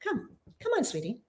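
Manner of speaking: high, soft sing-song call to a cat; coaxing pet voice. Real, not acted. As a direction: coax the cat up to you, high and gentle. Calling her cat to come in.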